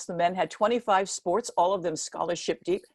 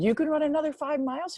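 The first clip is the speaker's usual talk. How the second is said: surprised; 9 semitones higher than usual. Retold or imitated. Coach astonished at her.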